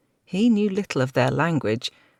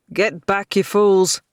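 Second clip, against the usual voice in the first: loud, urgent warning cry; shouted; 5 semitones higher, 6 dB louder, slower than usual. A narrator's reading. Knight warning soldiers off the bridge, shouting. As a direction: bark it at the crowd of men. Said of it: "he shouted".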